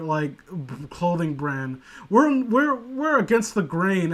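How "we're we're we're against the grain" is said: higher, smug mock teen; a pleased little mock boast from a fan. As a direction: sneer at the Hot Topic crowd. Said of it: "they say".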